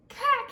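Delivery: sharp high loud gasped cack; a loud squawk of alarm; shocked gasp. Acted. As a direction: choke out a startled cry. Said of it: "he gasped".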